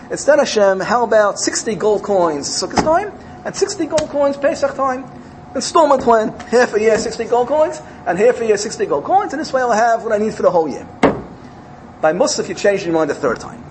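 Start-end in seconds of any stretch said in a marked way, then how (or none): 0.1-10.9 higher, louder bargaining with God; eager pleading. Acted. A poor chassid bargains with Hashem, pleading eagerly and higher. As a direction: pitch the installment plan hopefully.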